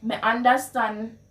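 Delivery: simple Patois Me understand; Jamaican Patois. Shown as a demonstration. Telling someone you understand, in Jamaican Patois. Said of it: "how you would translate".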